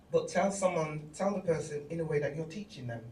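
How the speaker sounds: ordinary talk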